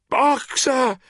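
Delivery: high, loud anguished cry; terrible cry. A narrator's reading. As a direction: scream his name in anguish. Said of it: "cried Clover in a terrible voice".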